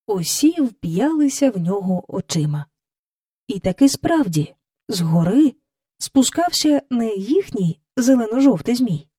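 ordinary delivery

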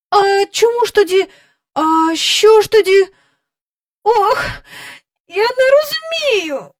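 put-on high-pitched, crying, frightened girl; child breaking into frightened tears; tearful horror. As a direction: wail in bewildered horror. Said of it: "заплакала Джейн з жахом".

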